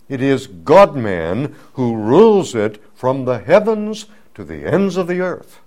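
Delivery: ordinary talk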